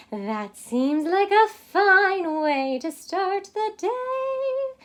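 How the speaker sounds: little yellow bird chirping; chirped. Acted. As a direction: chirp it sweetly. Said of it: "chirped the bird".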